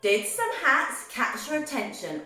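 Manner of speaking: usual speaking voice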